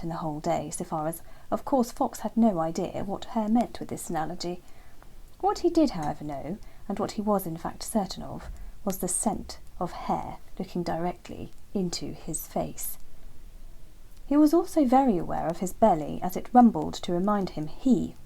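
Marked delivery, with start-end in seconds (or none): none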